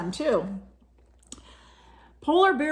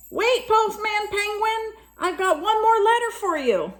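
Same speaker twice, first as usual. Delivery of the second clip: calling out; 12 semitones higher than usual. A narrator's reading. Polar Bear calls after penguin.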